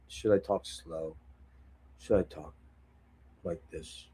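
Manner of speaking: dying guy. Pretend. Host offers the chat a dying man's voice, low and fading. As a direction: sound like you're on your last breath. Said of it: "talk like I'm a dying guy".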